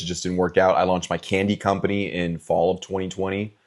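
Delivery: ordinary talk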